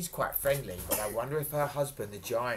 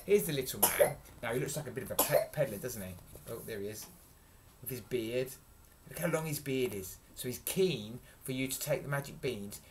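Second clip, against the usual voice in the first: plain reading voice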